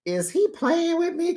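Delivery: very high offended question; high incredulous quote. Retold or imitated. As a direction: squeak it in disbelief. Quoting Trisha's irritation.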